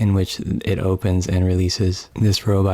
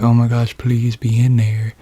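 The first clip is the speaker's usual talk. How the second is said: higher, pleading prayer to the pod; anxious hope, voice pitched up; desperate plea; 4 semitones higher, 5 dB louder than usual. Acted. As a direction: beg that she's in there. He hopes girlfriend is inside.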